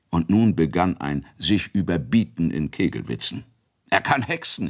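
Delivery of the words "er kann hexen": neckender Kegelwitz. Acted. Kegelwitze der Bauern.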